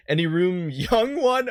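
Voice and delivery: high put-on voice breaking into a laugh; a misfired character voice that cracks him up; wrong voice for her. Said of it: "I picked the wrong voice for that".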